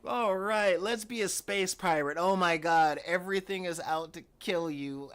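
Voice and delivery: high, loud hammed-up outburst; mock excitement. Comic deciding to be a space pirate, loud mock excitement.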